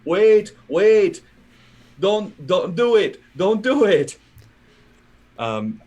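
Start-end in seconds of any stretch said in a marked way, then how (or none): 0.1-4.2 high, loud, urgent scream; desperate shout across a distance; screamed warning. Acted. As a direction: scream out to stop them. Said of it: "screaming in, in, in Sylvan".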